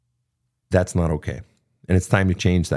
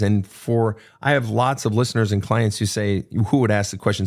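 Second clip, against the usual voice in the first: ordinary delivery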